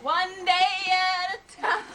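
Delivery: very slow, stretched words; slow drawn-out. Pretend. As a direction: drag every word out slowly. Drawn-out mock repeat.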